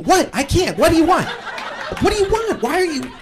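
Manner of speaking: high-pitched exasperated protest; flustered. Retold or imitated. Stuck driver answering the man yelling behind, flustered high protest. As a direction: act out helpless bewilderment, voice climbing.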